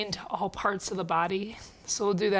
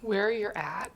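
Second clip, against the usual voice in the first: slow, softening meditation voice with long pauses; guided meditation; 4 dB quieter, slower than usual. Acted. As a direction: ease them gradually into stillness, slowly and quietly. Leads a seated check-in.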